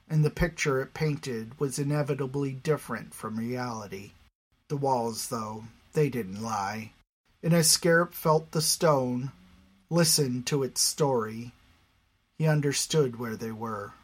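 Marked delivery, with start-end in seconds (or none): none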